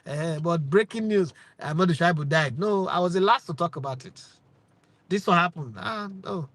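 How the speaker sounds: ordinary talk, nothing marked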